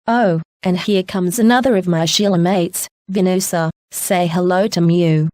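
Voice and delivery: usual speaking voice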